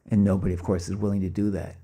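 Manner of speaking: usual speaking voice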